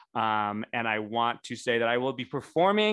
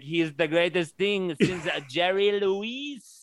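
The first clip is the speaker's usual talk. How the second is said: slow, hammed-up French accent, pitched up; mock French accent; 6 semitones higher, 3 dB louder, slower than usual. French fans praising her, in a hammy mock French accent. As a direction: play a French fan gushing in a cheesy French accent. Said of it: "they're gonna be like".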